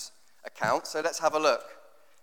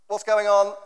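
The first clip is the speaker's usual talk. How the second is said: higher, louder mock bafflement; bewildered reader; 6 semitones higher, 8 dB louder than usual. Puzzled reader thinking aloud over the passage, louder and higher in mock bewilderment. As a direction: play up the reader's confusion. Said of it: "you think".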